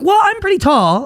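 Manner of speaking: high, naive mock reply; mock clueless salesman.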